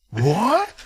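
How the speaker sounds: high-pitched bewildered question; exaggerated surprise, imitated; mock shock. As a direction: play up the baffled double-take. Acts out Buck's startled reply.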